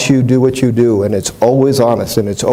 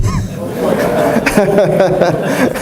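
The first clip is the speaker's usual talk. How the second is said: short high laugh; 9 semitones higher than usual. Real, not acted. Laughs at his own suspense.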